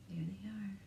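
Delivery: soft unvoiced whisper; hushed aside. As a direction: point it out in a whisper.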